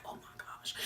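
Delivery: breathless whisper of disbelief; whispered aside. Retold or imitated. Narrator whispering her shock at the fight. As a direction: whisper it under your breath.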